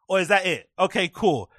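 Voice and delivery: normal talking voice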